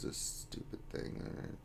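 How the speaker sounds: whispered, annoyed grumble; muttered under breath. Real, not acted. Streamer grumbling at the game to himself in a whisper. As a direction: hiss it to yourself, fed up.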